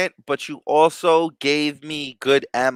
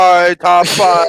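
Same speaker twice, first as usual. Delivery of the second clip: slow, drawn-out, booming ring announcer; 7 dB louder, much slower than usual. Retold or imitated. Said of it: "'Cause he's always talking like an announcer".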